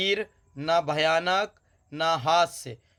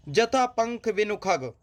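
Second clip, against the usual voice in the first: slow, higher quoted verse; poem recitation; 4 semitones higher, slower than usual. Acted. Teacher quoting the verse to students, recitation. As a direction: lift the phrase like poetry.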